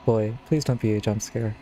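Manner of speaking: ordinary delivery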